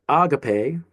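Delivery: "agape" as AH-ga-pay, first syllable stretched; "agape" with the accent moved to the front; stress on first syllable. Shown as a demonstration. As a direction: slowly, hit the opening A of "agape". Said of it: "it feels different if you say".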